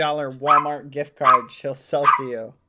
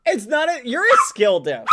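indignant; 9 semitones higher, 8 dB louder than usual. Real, not acted. Playful argument.

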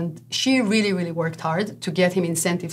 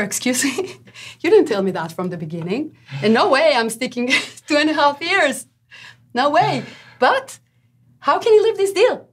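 much higher and louder, half laughing in disbelief; shocked outrage; 7 semitones higher, 4 dB louder than usual. Retold or imitated. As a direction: replay your own stunned protest. Shocked agent confronts lender.

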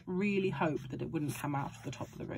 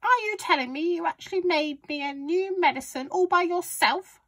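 high, loud, incredulous granny; shouting grandma; 11 semitones higher, 5 dB louder than usual. A narrator's reading. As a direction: demand it in disbelief as Grandma. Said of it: "she shouted".

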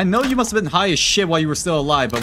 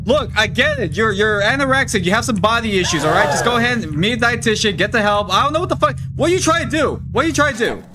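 high frustrated yell at the demon; yell, fed up and interrupted; angry yelling; 9 semitones higher, 4 dB louder than usual. Real, not acted. Ranting at the game demon.

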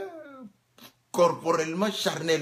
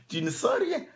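rien thrown up much higher in disbelief; incredulous echo. Shown as a demonstration. Preacher mocking the idea that you feel nothing, high and incredulous.

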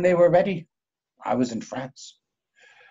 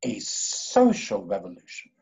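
slow disbelieving echo; 9 semitones lower, 4 dB quieter than usual. Acted. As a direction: lower and slow, aghast.

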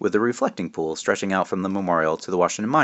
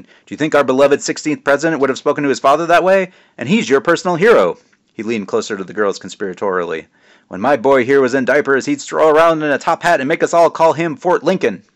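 higher, louder jovial dad voice; teasing dad; 6 semitones higher, 7 dB louder than usual. Acted. Father teasing his son at the memorial, jovial and loud. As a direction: play the proud joking father.

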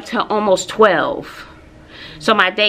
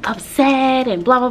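normal talking voice

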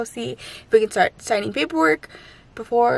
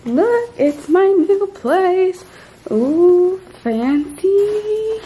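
excited; 8 semitones higher, much slower than usual. Real, not acted. First look at new place.

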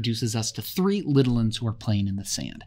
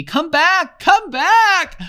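much higher, louder, frantic scream; insane scream; 9 dB louder, much slower than usual. A narrator's reading. Boy screaming insanely at the vanished ship, frantic. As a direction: voice rising out of control. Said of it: "his voice rose insanely".

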